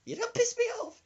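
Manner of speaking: very high falsetto outburst; squealed mock-angry parting shot; fuming old woman. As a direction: imitate an irate granny up high. Old woman fuming while running out.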